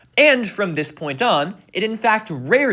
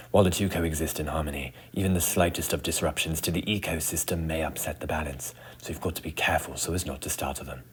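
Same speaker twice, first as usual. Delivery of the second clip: hushed nature documentary narrator; soft, low wildlife narrator voice; 12 semitones lower, 10 dB quieter, faster than usual. Acted. Nature documentary parody opening.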